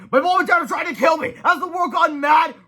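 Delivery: very high, loud panic; high loud frantic cry; panicked shouting. Acted. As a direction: wail in disbelief. Man fleeing attacking parents.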